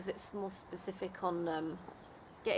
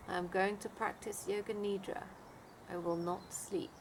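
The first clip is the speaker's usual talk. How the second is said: guided meditation; 4 dB quieter than usual. Acted. Leads a Yoga Nidra meditation.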